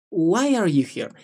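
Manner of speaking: "Why are you here?" run together without gaps; one smooth arc over "Why are you here?", falling at the end; linked question. Shown as a demonstration. Teacher shows the union of the question, words linked. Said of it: "La unión de la pregunta".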